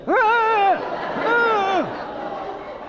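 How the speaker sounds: put-on high delighted toddler squeal; happy squeal. Dad mimics toddler's delighted squeal, high.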